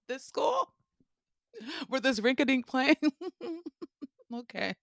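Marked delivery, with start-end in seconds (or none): 0.1-2.9 high-pitched amused disbelief; incredulous. Real, not acted. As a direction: ask it in disbelief, close to cracking up. Incredulous, laughing about the plane.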